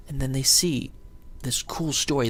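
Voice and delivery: ordinary talk, nothing marked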